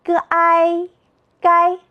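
"g i gai" chanted high and level, g, āi, then gāi; pinyin blending. Shown as a demonstration. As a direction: sound out "g i", then join as "gai", all first tone. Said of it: "我们一起读".

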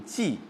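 “骑” said jì, falling tone; noun reading jì. Shown as a demonstration. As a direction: pronounce “骑” as jì, like 继. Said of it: "都读". (“骑”读作第四声 jì)

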